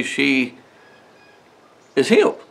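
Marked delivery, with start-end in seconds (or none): none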